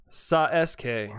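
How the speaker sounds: Sa-S-K said slowly as sah-ess-kay, joking mispronunciation; wrong on purpose. Shown as a demonstration. To chat, showing a joke way of saying the name, slow and playful. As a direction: clip Sa-S-K into three flat syllables, sah-ess-kay. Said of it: "That's pronounced".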